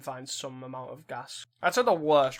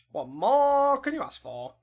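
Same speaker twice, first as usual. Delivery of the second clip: mock hype; 9 semitones higher, 4 dB louder, slower than usual. Hyping own hand.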